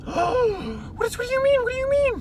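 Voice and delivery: high, loud panicked questions; alarmed. Acted. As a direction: voice climbing in alarm at the news. Character shocked by secret.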